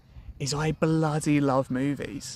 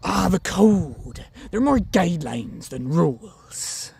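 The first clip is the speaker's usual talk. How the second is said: slow, drawn-out film pirate impression, pitched up and loud; Captain Barbosa; 4 semitones higher, 4 dB louder, slower than usual. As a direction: stretch the words like a theatrical pirate captain. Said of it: "there's a guy in there called Captain Barbosa".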